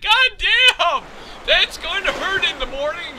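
high, loud complaint; mock pained yelp. Wolf kicked in the sides by rider, mock pained yelp. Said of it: "He's like".

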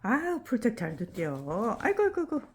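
loud, high-pitched delighted cries; excited exclamations. Real, not acted. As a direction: squeal it out in delight. Excited at the dog.

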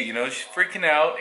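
normal talking voice

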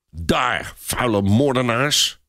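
slow, hissed insult; venomous hiss. A narrator's reading. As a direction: hiss it slowly with contempt. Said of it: "sist hij".

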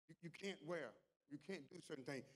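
normal talking voice

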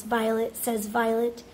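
plain reading voice

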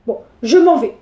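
ordinary delivery, nothing marked

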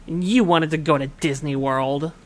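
normal talking voice

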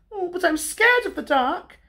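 very high, worried cub voice; Little Bear. A narrator's reading. Little Bear resists going into the night, high scared cub voice. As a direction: protest high like a frightened cub.

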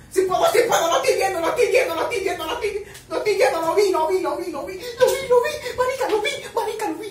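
high, loud giddy chanting; excited laughing shouts. Real, not acted. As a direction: laugh and shout as you finally see it. Excited fan watching kiss.

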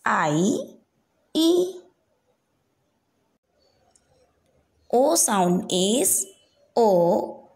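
slow, clearly spaced vowel sounds for the class to copy; धीमी आवाज़ में दोहराने वाला अभ्यास; slow repeat-after-me drill. Shown as a demonstration. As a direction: model each vowel slowly, pausing for the children. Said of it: "एक बार फिर से मेरे साथ पढ़िए".

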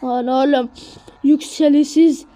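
normal talking voice

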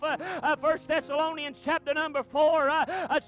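loud, high preacher cadence, fast at the start; preaching at full force. Acted. Pastor proclaiming the return to the church, preached hard. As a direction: climb toward the shout of the archangel.

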